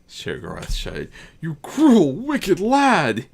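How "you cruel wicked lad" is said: louder, awed and uneasy praise; fearfully praising. Acted. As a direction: praise him while half afraid of him. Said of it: "he praised fearfully".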